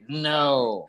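normal talking voice

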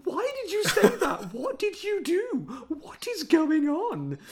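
high, loud, laughing disbelief; reacting to a baffling film scene with high laughing disbelief; incredulous disbelief. Real, not acted. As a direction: squeal out the bafflement, half laughing. Mocking the film's baffling moment.

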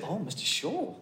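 quiet, gentle praise; soft nurse voice. Retold or imitated. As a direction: hush it like a kind nurse. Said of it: "she said".

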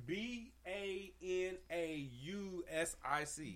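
slow letter-by-letter spelling. Shown as a demonstration. Quizmaster to players, slow letter-by-letter spelling. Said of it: "reads the spelling".

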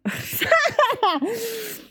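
high-pitched cracking-up laugh; helpless laugh. Real, not acted. Narrator can't say the word, high helpless laugh. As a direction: burst out laughing at your own flub, high.

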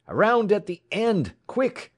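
very high, loud call to action; urgent cry. A narrator's reading. Wrecker on the pilot car rallies his companion, urgent cry. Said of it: "from the pilot car rose the cry".

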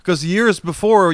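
high, loud, slow story opener; emphatic. Real, not acted.

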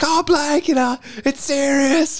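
very high, slow mock whine with a laugh; whiny little sister. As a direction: ham up a dramatic teen whimper. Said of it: "I'm trying not to laugh".

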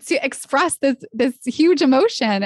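high, overwhelmed gush of someone in love; lovestruck gushing. Acted. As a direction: pitch it up as if swept away by love. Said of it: "you fall in love and you're like".

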